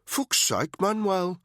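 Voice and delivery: high, loud contemptuous sneer; nasty sneering jibe. A narrator's reading. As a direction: spit the name out with contempt. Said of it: "he said with an unpleasant sneer".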